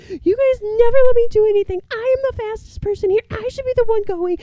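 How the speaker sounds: high, loud, fast mock fit; mock tearful tantrum. Sister demanding to be the one going, mock crying tantrum. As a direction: throw a hammed-up bratty fit at full pitch. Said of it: "crying, like, slash throwing a fit".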